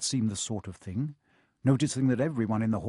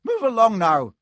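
much higher and louder, clipped command; sharp professor; 11 semitones higher, 9 dB louder than usual. A narrator's reading. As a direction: snap the order at the ghosts. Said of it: "said a sharp voice".

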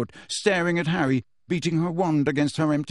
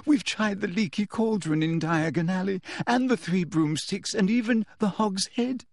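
put-on higher, tearful girl's voice; fake sobbing; 6 semitones higher than usual. A narrator's reading. Girl feigns confession to teacher, sobbing. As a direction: list it plaintively, as if crying. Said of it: "sobbed Hermione".

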